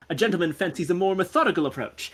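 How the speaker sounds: theatrical, self-assured gentleman sleuth; gentleman investigator. Acted. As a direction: play a pompous detective with lively pitch swings.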